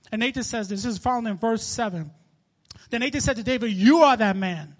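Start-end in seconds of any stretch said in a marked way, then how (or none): 3.7-4.7 loud, slow declaration; prophet's booming verdict; accusing. Acted. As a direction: land the accusation hard and deliberately. Prophet's accusation quoted from scripture.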